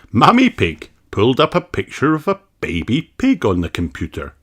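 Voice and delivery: ordinary delivery, nothing marked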